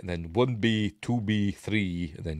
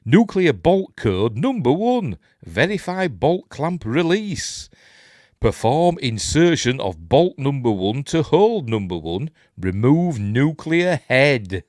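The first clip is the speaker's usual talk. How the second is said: loud, slow, over-emphatic instruction reading; booming procedural announcement; 4 semitones higher, 6 dB louder, slower than usual. Acted. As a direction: declaim each step word by word. Reads on-screen instructions aloud.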